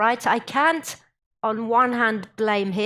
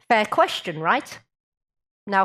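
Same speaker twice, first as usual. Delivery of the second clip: ordinary talk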